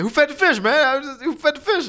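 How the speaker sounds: mock furious father; angry shout. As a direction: bellow at the kids like an outraged dad. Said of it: "he said".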